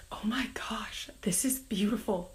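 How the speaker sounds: soft whispered wonder at the flowers; hushed awe over the blooming flowers; awed whisper. Retold or imitated. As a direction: breathe it out in amazement.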